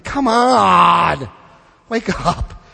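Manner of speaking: long drawn-out, exasperated cry; exasperated plea. Real, not acted. Speaker urges believers to wake up, exasperated plea. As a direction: drag it out in disbelief.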